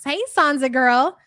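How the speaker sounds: normal talking voice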